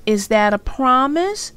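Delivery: lightly voiced sister checking; little sister asking. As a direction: pin him down. Little sister questioning brother.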